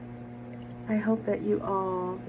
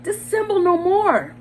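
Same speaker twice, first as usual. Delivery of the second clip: high, loud, desperate yelling; frenzied confession; 10 semitones higher, 11 dB louder than usual. Acted. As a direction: break down and scream the truth. Madman confesses, shrieking.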